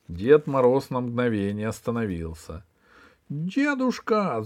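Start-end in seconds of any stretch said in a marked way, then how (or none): 3.3-4.4 put-on high, drawn-out girlish call; plaintive high cry for grandfather; crying out Снегурочка. Снегурочка calls after Дед Мороз, high drawn-out cry. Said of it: "закричала Снегурочка".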